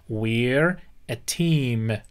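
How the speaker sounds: very slow, we're run into one syllable; slow model phrase. Shown as a demonstration. Teacher modelling the phrase for students, very slow. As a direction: model it slowly for the class.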